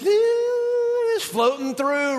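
very slow, stretched, floaty voice; hammed-up dreamy drawl; mock floating. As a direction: drift through it with one long sustained sound. Mimics drifting in the afterlife.

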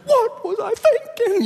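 a shocked, squealing regret; mock cry of shock.

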